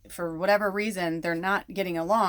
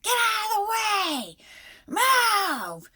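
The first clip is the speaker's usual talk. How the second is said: high, stretched-out mock scream; imitated screaming; 4 dB louder, much slower than usual. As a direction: shriek at the horse to get moving. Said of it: "she's, like, screaming".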